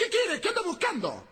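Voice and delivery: high, furious wife shouting from the balcony; woman yelling from the balcony at the drunk; angry wife yelling. Retold or imitated. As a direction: screech down at the drunk.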